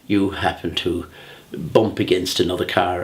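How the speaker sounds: ordinary talk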